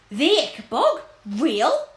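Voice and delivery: high, loud gasp of disbelief; a startled innkeeper who can't believe it; astonished and afraid. A narrator's reading. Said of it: "said the landlord in astonishment and fear".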